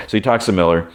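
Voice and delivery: ordinary delivery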